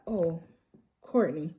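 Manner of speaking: low, soft, caught-out voice; sheepish realization. Retold or imitated. Marty notices Courtney overheard, low sheepish mock surprise. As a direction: drop down as if just caught out.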